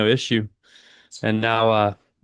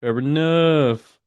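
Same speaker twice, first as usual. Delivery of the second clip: drawn-out fair enough; 4 semitones higher, much slower than usual. Acted. Streamer mulling a chat comment.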